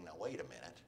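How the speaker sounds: low, soft second thought; dawning doubt. Acted. Spouse rethinking the divorce a month later, quietly doubtful. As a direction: say it quietly, just starting to realize.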